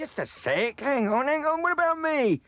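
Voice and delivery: loud, high call as the door shuts; calling after her. Acted. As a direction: shout after her not to leave you. Left behind calling out.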